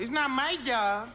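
put-on slow Puerto Rican accent, dismissive; lazy Puerto Rican super brushing off a tenant; Puerto Rican manager.

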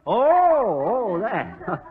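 ordinary talk, nothing marked

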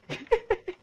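sudden high-pitched giggle; a quick amused squeal; high laugh. Real, not acted. Reacting to game.

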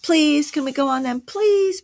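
put-on high, begging child; pleading. A narrator's reading. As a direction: plead eagerly, pitch climbing to the last word. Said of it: "pleaded Ethan".

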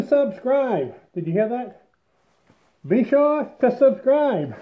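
muffled behind face mask; speaking inside half-mask respirator; through a respirator mask. Real, not acted. Seen 5: Respirator covers mouth until pulled down around 10.8; goggles stay on.

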